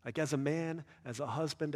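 normal talking voice

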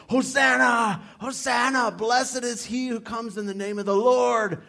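loud, high shouted hosannas like a jubilant crowd; crowd shouting. Retold or imitated. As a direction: call it out like the cheering crowd at the gate. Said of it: "And they were shouting".

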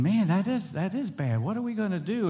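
lightly voiced anxious wife; wife upset. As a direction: voice the wife's rising alarm. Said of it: "she's getting upset".

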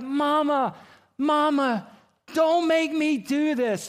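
high, drawn-out pleading yell; imitating screaming child. Imitating son begging his dad as he's wheeled away, screaming. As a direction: cry out like a terrified little boy. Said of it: "he's screaming. He's like".

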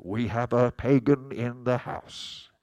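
lightly put-on public warning, loud and drawn out; mock announcement. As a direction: announce it slowly with mock gravity. Mock message sent to the pastor.

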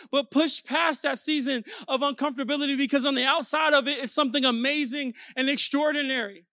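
driving motivational sermon cadence; preaching. Real, not acted. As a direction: urge the congregation on with repeated punchy commands. Preacher exhorting the congregation.

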